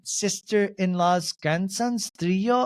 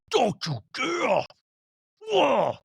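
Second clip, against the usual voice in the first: mock shout; 9 semitones higher, much slower than usual. Comedian acts out Meredith warning Whitney, shouting. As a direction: drag out every word as a threat. Acted quarrel recap.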